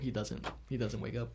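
a hint of hushed gloom, low and soft; mock-mournful echo of a sad line; mock somber echo. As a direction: echo her grave tone lightly. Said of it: "The way you say it".

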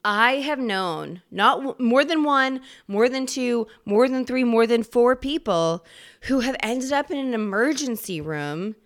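ordinary talk

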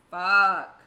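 sudden loud curse; a sharp shouted swear while the cop is away; frustrated shout. Retold or imitated. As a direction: blurt it out in a loud burst. Panicking waiting for ticket.

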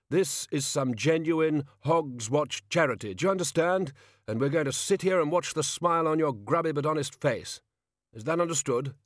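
high, insistent kingly rebuke; sharp. A narrator's reading. King ordering peasant to be grateful, sharp. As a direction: lay down the law like an offended monarch. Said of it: "said the king sharply".

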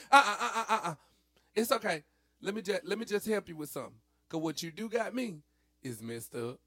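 high, loud uh-uh; sassy. Pretend. To an imagined person, a high loud sassy uh-uh. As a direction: wag the finger with your voice.

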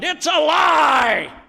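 slow, high, very loud outburst of protest; furious shout. Retold or imitated. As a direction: bellow it out as an outraged denial. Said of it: "Alfred shouted".

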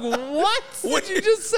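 stunned, laughing at the surprise; laughing disbelief. Retold or imitated. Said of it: "I was like".

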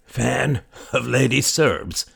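put-on slow, wheezing old man; wheezy voice. A narrator's reading. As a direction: wheeze the words out slowly. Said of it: "the man wheezed".

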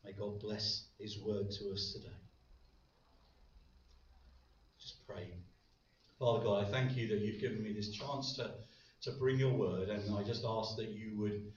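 hushed opening prayer. Acted. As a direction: pray it gently before the sermon.

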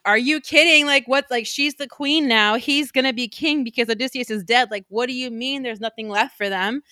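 high, loud are you kidding; incredulous exasperation. Acted. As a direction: argue in disbelief. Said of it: "Cersei is like".

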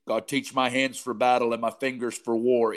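ordinary talk, nothing marked

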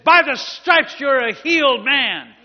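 slow, high, very loud proclamation; shouted healing command. Retold or imitated. Healer ordering the healed man off his stage, acted commanding shout. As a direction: bellow it phrase by phrase as an imitated fiery healer.

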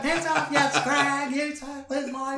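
hammed-up high girly insult; mock woman yelling. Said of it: "then they'll be like".

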